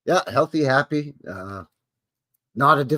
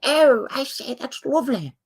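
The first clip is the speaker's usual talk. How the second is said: high, hammed-up upper-class English exclamation; posh British accent; 14 semitones higher than usual. Host mocks the English crown in a posh British accent. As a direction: toff from jolly old England, mock delight. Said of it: "over in jolly old England".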